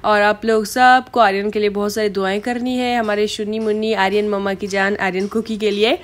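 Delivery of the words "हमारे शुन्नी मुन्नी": ordinary talk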